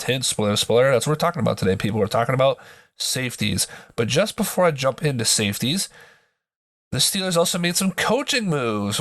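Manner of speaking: ordinary talk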